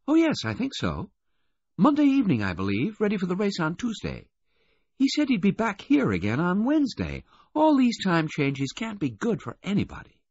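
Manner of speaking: very high, animated woman's voice; Missus Baudelaire. A narrator's reading. Missus Baudelaire giving Bill's travel plans, in a very high voice. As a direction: answer brightly in a high register.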